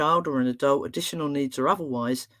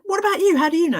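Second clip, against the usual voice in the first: very high, louder challenge; pressing retold question; 14 semitones higher, 6 dB louder, faster than usual. Therapist challenging the boy, high and pressing. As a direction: throw the question back at the child.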